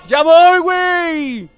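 high, loud drawn-out holler; shouting out. Real, not acted. Player calling to his teammates that he's coming, shouted. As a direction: holler it to someone far off. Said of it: "Ahí le grité".

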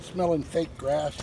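ordinary talk, nothing marked

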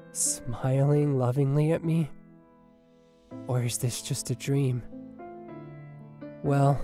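low, measured, loving voice; tender wedding vows. Acted. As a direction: pledge yourself to your bride, pausing between phrases. Groom speaks vows in dream.

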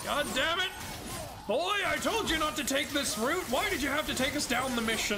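high, hammed-up scolding dad; angry father voice. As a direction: scold the boy theatrically. Scolding the boy as Kratos.

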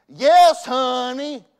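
loud, high, squeezed out; mock strained yes honey; strained forced yes honey. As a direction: act a man who can barely say it. Farmer struggling to say it.

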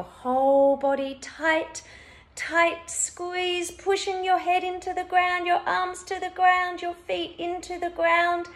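high, loud, insistent coaching; urging to squeeze. Shown as a demonstration. Instructor urging one last whole-body squeeze, urging to squeeze. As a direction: drive the effort up and keep it there.

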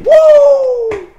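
loud, very high "woo"; piercing hyped-up whoop; excited whoop. Real, not acted. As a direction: let out a long high holler. Hyped reaction to the bars.

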